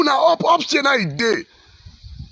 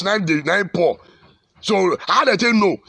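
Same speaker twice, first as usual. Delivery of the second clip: usual speaking voice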